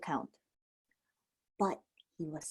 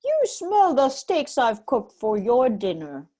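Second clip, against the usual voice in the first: giant's wife; 6 dB louder than usual. A narrator's reading. Wife covers for Jack.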